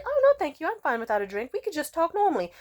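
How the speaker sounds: high, sweet, overly polite refusal; chirpy high polite no to pushy men; polite decline. Retold or imitated. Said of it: "when I say".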